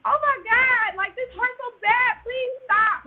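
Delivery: high, loud retold wail; crying in pain. Patient begging doctor during catheter insertion, crying in pain. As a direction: reenact a pained, pleading cry. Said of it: "Like, I'm crying".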